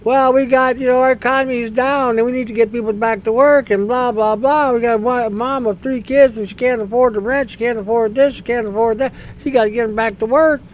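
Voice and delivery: high, whiny parody of a Conservative MP; mock the pleading in a high voice; mock politician. Parodying a Conservative MP pleading in Parliament, high and whiny. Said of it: "they all said the same thing".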